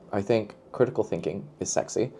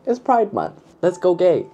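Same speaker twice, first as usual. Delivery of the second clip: excited; 10 semitones higher, 5 dB louder than usual. Real, not acted. Hyping Pride Month read.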